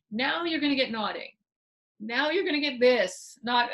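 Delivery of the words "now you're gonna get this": normal talking voice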